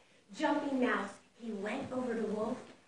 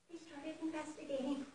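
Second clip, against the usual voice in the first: usual speaking voice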